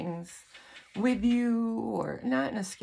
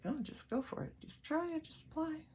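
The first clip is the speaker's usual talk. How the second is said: gentle nudging voice; 10 semitones higher, 8 dB quieter than usual. Pretend. Voicing guides' gentle nudge.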